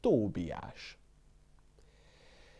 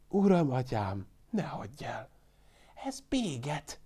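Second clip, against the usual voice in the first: whispering; 4 semitones higher, 3 dB quieter than usual. A narrator's reading. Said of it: "suttogta az öreg".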